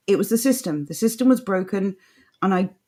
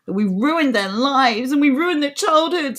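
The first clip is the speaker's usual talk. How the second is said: mock outrage; 6 semitones higher, 3 dB louder than usual. Imitating online opponents blaming people for existing, mock-outraged. Parrots the complaints of Ramonas.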